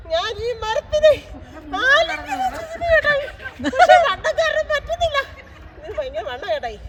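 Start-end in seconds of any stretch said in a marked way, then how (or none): 0.1-5.2 high, slow laughing mock sob; laughing while fake crying. Real, not acted. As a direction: laugh through a playful pretend cry. Hugs tree, laughing and fake crying. (ചിരിച്ചുകൊണ്ട് കരയുന്നതുപോലെ)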